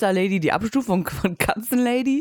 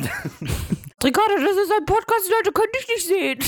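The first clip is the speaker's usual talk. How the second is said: high teasing scold, pitched way up; mock scolding; 13 semitones higher than usual. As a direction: scold her in a high mocking voice.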